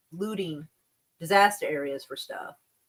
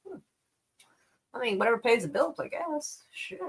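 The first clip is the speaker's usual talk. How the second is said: ordinary talk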